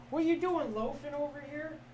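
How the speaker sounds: high, lilting voice for a cat; playful pet talk. Real, not acted. Streamer teasing his loafing cat, high playful pet talk.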